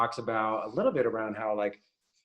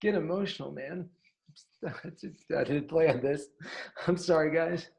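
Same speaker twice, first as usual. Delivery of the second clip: holding back tears, breaking high; quiet weeping; 5 semitones higher than usual. Real, not acted. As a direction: voice choking up with tears. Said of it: "I got emotional".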